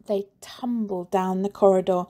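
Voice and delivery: ordinary delivery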